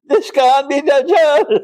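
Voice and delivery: high, laughing mock insistence; mocking Salafi brothers. Lecturer mocking Salafi brothers who reject the sign, high and laughing. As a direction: ham up the scolding brothers. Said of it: "my Salafi brothers will say".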